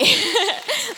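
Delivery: short, high laugh; brief amused laugh between phrases; real laugh. Real, not acted. Speaker about to get into the word, laughing. Laughs before starting the teaching.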